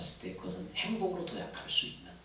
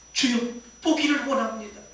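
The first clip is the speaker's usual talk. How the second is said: higher, louder earnest answer; imitated beggar pleading, voice raised; beggar's plea; 7 semitones higher, 6 dB louder than usual. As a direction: cry out the request to the Lord. Blind beggar answering Jesus.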